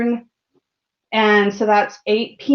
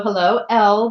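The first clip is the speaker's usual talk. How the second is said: ordinary talk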